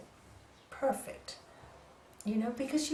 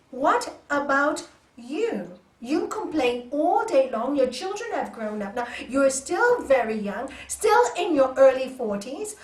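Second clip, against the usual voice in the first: loud, slow scolding rant; rude scolding; 9 dB louder than usual. Retold or imitated. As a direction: tell her off, loud and slow. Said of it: "I was really quite rude to her".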